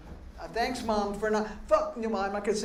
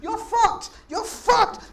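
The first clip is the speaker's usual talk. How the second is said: high yelled punchline; 12 semitones higher, 5 dB louder, much slower than usual. Acted. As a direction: shout it way up high and loud. Punchline in owl rant.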